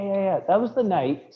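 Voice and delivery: normal talking voice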